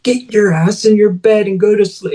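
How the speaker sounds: high, furious shouted order; angry yelling. Retold or imitated. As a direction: bark the kid off to bed. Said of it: "starts going off on me".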